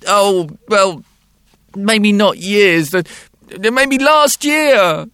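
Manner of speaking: put-on high, loud, hesitant child; a small boy backing down slowly; little boy voice. A narrator's reading. Boy's voice in story.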